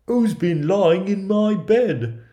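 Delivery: very high, loud, drawn-out dwarf cry; indignant storybook dwarf, calling out; dwarf's cry. A narrator's reading. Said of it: "and cried".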